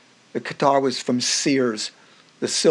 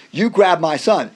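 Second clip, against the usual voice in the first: slow, louder accusation; angry father; 5 semitones higher, 6 dB louder than usual. Retold or imitated. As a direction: spell out the charge, furious dad. Angry father confronts narrator.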